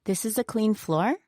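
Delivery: questioning rise on "floor"; upward intonation. Shown as a demonstration. Co-teacher asking whether it is clean, upward intonation on "floor". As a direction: lift the pitch at the end of "floor" to ask. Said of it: "with an upward intonation".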